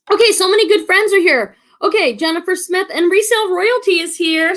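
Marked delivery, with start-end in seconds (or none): none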